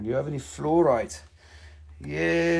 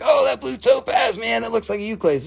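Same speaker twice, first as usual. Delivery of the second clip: mock viewer exclaiming; 8 semitones higher, 6 dB louder than usual. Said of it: "You're like".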